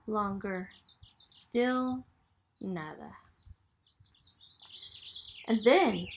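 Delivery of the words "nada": dropped low and soft, almost gone; hushed nada. A narrator's reading. Narrator letting the disappointment sink in, hushed. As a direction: let the word fall away to nothing.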